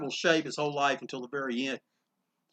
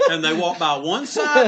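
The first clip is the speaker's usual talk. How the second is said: normal talking voice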